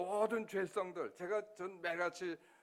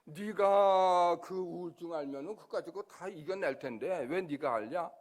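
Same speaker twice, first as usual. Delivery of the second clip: voice of God; 9 dB louder than usual. Retold or imitated. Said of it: "그러니까는".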